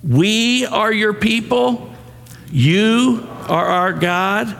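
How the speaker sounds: measured prayer for a congregation to join in; leading congregational declaration. Real, not acted. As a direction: lead the room in saying it, drawing out each phrase. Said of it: "Will you say it with me to him then?".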